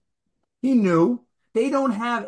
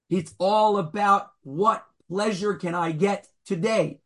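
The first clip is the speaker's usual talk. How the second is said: usual speaking voice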